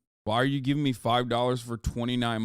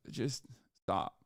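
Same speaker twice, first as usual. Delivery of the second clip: weary plea; 9 dB quieter than usual. Real, not acted. Pleading viewers to stop.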